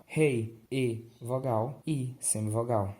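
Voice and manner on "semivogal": ordinary talk, nothing marked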